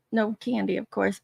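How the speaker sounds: ordinary delivery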